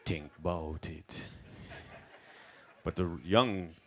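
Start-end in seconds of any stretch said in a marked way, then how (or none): 0.0-1.2 a hint of a Rasta preaching calmly; quiet Rasta elder musing; lightly voiced Rasta preacher. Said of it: "the roots man's preaching is like".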